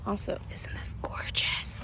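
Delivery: soft breathy whisper, no voice. Real, not acted. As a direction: whisper it like a secret. Aside about a plant.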